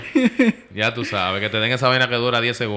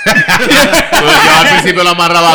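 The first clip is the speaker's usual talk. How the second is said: laughing; 6 semitones higher, 12 dB louder, much slower than usual. Real, not acted. Laughing while recalling double knot.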